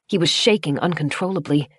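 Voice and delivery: usual speaking voice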